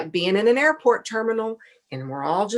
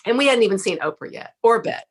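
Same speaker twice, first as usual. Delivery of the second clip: ordinary talk, nothing marked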